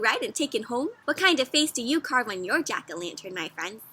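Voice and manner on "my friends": plain reading voice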